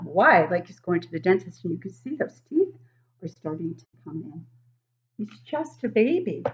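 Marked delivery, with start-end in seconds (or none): none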